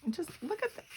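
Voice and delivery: gushing softly to viewers about vintage photos. Real, not acted. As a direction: swoon over the picture. Gushing over vintage pictures.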